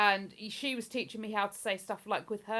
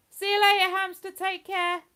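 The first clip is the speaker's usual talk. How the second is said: higher, louder fond goodbye; higher, louder tender send-off; tender farewell; 10 semitones higher, 8 dB louder than usual. Real, not acted. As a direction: wave off the lost hamster fondly. Farewell to deceased hamster.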